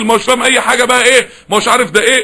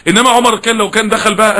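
usual speaking voice

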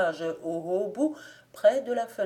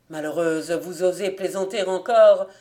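indignant rebuke; 7 dB louder than usual. A narrator's reading. Lover rebukes the servant's joke.

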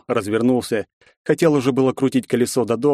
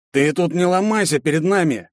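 higher, nasal thug sneer; nasal gopnik; 7 semitones higher than usual. Acted. Redheaded thug taunts the girl, nasal sneer. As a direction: whine it like a street thug. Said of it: "прогундосил один из гопников".

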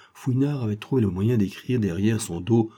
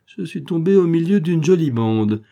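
loud, higher-pitched indignation; exasperated exclamation; 7 semitones higher, 6 dB louder than usual. A narrator's reading. Policeman discovering a band of rogues, exasperated. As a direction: exclaim in disgusted realisation. Policeman realises he faces a gang.

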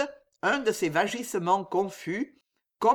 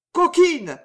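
sharp furious cry of Coquine; angry shout; 10 semitones higher, 7 dB louder than usual. A narrator's reading. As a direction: spit out the insult in rage. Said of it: "cria le vieux Rival avec une colère".

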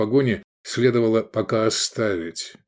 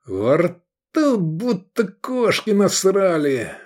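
high, slow, whining groan; hungover groan; 9 semitones higher, much slower than usual. A narrator's reading. Iskander peeling himself off the bench, whining hungover drawl. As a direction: drag the words out queasily. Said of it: "и проговорил".

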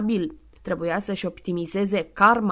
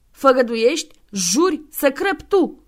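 demanding oath; 5 semitones higher, 7 dB louder than usual. Acted.

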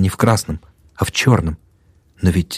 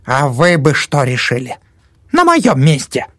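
put-on attacking old woman; 7 semitones higher than usual. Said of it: "всё нападала она". (нападает, высоко и громко)

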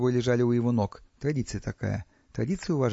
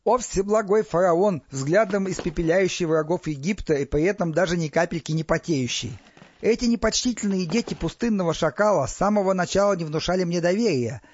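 raised, declamatory flattery; bursting into oration; 5 semitones higher, 3 dB louder than usual. A narrator's reading. Priest flatters pharaoh and accuses prisoners, declaiming. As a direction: declaim to a ruler, pitched up. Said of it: "разразился речью".

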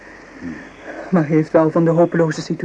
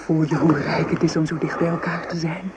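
ordinary delivery

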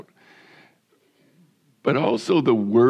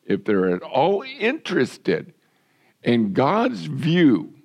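raised, pushing sermon voice; fervent preaching; 3 semitones higher than usual. Acted. Pastor urging his congregation to stand for righteousness, preaching hard. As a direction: press the point home to the flock.

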